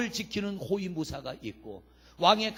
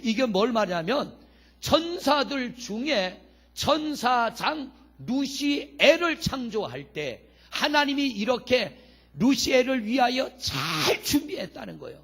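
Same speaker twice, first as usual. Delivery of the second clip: normal talking voice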